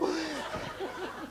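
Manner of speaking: a high breathy laugh of glee; giddy high laugh. Retold or imitated. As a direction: laugh out of sheer nervous joy. Excited laugh at the ceremony.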